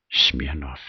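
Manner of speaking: ordinary delivery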